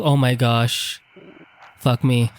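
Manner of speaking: ordinary talk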